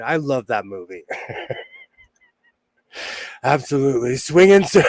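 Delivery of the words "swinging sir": louder, lightly voiced Austin Powers catchphrase. Host slips into Austin Powers for the chat, lightly voiced swinger. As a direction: toss it off like a groovy Austin Powers. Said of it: "doing the Austin Powers".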